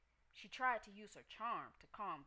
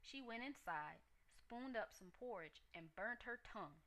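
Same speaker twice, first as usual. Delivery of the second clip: plain reading voice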